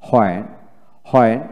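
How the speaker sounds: হয়েন with an audible h at the start; American h pronunciation. Shown as a demonstration. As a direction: sound the h in হয়েন. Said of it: "আমেরিকানে হয়েন উচ্চারণ".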